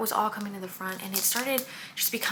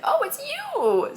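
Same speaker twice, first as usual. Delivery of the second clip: delighted discovery; 4 dB louder, much slower than usual. Retold or imitated. Friends sniff out who smells good.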